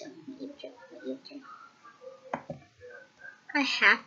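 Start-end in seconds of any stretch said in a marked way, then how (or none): 0.1-1.4 unvoiced, very soft words; hushed secret plan; whispered aside. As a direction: whisper it to yourself.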